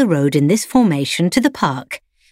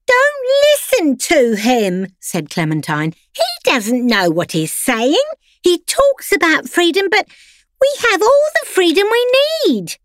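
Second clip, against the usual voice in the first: much higher, put-on voice of a pampered lapdog; prim pampered dog; 13 semitones higher than usual. A narrator's reading. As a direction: lecture him sweetly like a spoiled salon poodle. Clementine rebuts.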